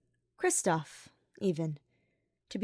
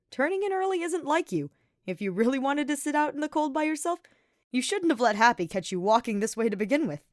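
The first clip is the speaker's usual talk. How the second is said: a lightly voiced, higher, easygoing knight teasing his friend; teasing Sylvain; 7 semitones higher, 4 dB louder than usual. A narrator's reading. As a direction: play Sylvain as a cheeky charmer, lightly sketched.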